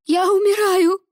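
high sobbing wail; crying on the phone. Acted. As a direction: sob it out in panic. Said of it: "рыдала Слава в трубку".

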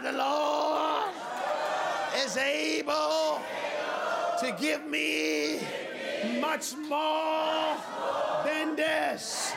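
slow, drawn-out preacher's shout; shouted declaration. Shown as a demonstration. Preacher leading somebody to shout the verse, shouted declaration. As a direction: belt out each phrase for the crowd to echo. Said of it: "Somebody shout".

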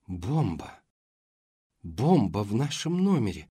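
horrified. A narrator's reading. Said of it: "ужаснулась она".

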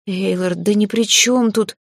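ordinary delivery

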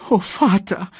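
high, tearful little voice; sobbing child. A narrator's reading. Simba thanks his father for rescue, sobbing. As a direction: whimper with relief to father. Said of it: "schluchzte Simba".

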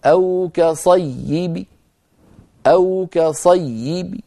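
slow recitation of "كصيب" with the final ba kasra faint; stopping with rawm. Shown as a demonstration. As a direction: let the kasra of "كصيب" fade to about a third of its sound. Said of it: "فننطقها بصوت ضعيف، هكذا". (وقف بالروم على "كصيب" بصوت ضعيف للكسرة)

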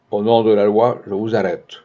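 ordinary delivery

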